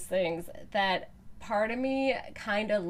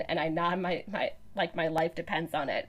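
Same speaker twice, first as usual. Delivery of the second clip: ordinary talk, nothing marked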